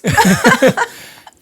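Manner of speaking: high cackling laugh; loud high laugh. Real, not acted. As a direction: break up laughing at the joke. Laughing at joke.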